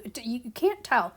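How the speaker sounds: ordinary talk, nothing marked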